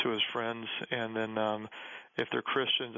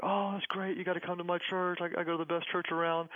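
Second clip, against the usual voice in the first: higher-pitched, eager invitation; excited young evangelist; 6 semitones higher than usual. Retold or imitated. Young guy eagerly inviting pastor to his church, excited. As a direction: gush like an excited church kid. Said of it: "he was all excited".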